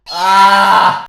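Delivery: loud cry of exasperation; short, loud outburst; exasperated yell. Real, not acted. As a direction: yell out at the cringe. Reacts to cringe clip.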